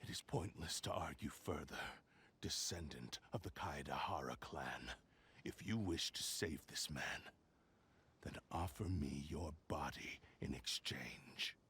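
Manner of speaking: low, hushed and threatening; menacing whisper. Acted. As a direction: breathe the ultimatum, soft and cold. Blade's menacing ultimatum to Kazuha.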